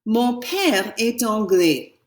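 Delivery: "Mon père est anglais" said very slowly, stress on père, falling at the end; teacher saying the translation; slow French model. Shown as a demonstration. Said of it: "How about".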